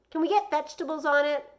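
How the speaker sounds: put-on a boy pleading for vegetables, high and eager; hopeful kid. Young Joey asks his mom about pizza toppings, high and eager. Joey's child voice.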